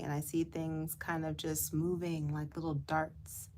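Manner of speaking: gentle meditation voice with long pauses; guided meditation. Real, not acted. Guide asking listeners to close eyes and float, guided meditation. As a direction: lead them gently inward, lingering on words.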